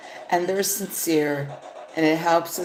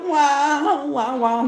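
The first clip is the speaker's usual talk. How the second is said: high whiny wah-wahs, louder; mock cat crying; 10 semitones higher, 3 dB louder, much slower than usual. Imitating the sad cat reel, high mock crying. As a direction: sob like a cartoon cat, pitched up. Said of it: "It's all".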